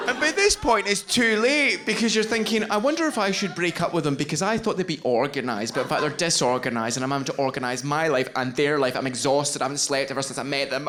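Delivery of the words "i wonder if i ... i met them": very fast, run-on inner monologue; racing thoughts. Acted. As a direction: rattle through the complaints without a breath. Acted racing thoughts of exhausted partner.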